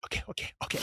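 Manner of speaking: quick whispered agreement; whispered okays. Retold or imitated. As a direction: whisper back, conspiring. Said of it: "she's like".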